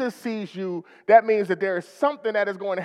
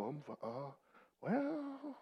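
downcast reply; 6 semitones lower, 20 dB quieter, much slower than usual. Pretend. Weary reply to a greeting.

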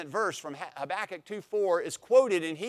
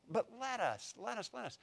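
softly repeated let us, let us; hushed let us; 7 semitones higher, 11 dB quieter than usual. Shown as a demonstration. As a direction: murmur the let us refrain quietly. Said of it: "he exhorts them with these hortatory subjunctives".

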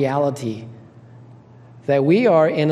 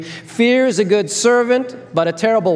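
put-on broad singsong kids theatre; 6 semitones higher than usual. Shown as a demonstration. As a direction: lead the kids in a chant. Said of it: "But let's do that again".

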